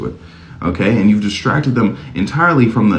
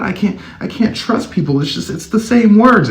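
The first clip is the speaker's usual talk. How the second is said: higher, whiny voice of a confused victim; 12 semitones higher than usual. Retold or imitated. Mocking a manipulated person who feels lost, high confused whine. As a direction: play someone who can't trust anyone anymore. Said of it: "They're like".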